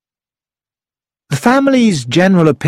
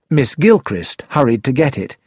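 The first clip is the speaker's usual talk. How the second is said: usual speaking voice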